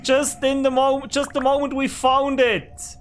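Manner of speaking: annoyed. Real, not acted. Gamer complaining about the broken pickaxe, loud and outraged. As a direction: disbelief as the tool breaks. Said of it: "Now I'm angry".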